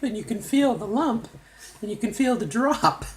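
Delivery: ordinary delivery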